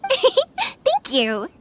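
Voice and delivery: a bright laugh, then a high cheerful thank you. Acted. Thanking the villager for the welcome, with a high laugh.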